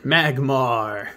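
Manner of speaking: ordinary delivery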